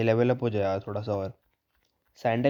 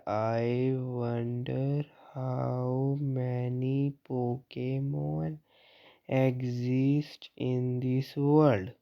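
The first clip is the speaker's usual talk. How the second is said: drawn out extremely slowly, word by word; mock very slow N; much slower than usual. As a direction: stretch every word like N talking. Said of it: "इतनी धीरे बोलता तू N". (N की नकल में बहुत धीमा)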